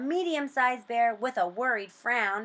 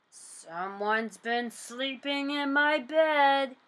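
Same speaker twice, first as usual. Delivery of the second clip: growling; 3 semitones higher, slower than usual. A narrator's reading. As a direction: growl it slowly like a big bear. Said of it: "growled Papa Bear".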